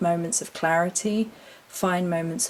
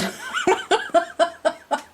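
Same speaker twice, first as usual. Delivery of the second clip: high giggle; 13 semitones higher, 3 dB louder than usual. Real, not acted. Laughing at her own nonsense.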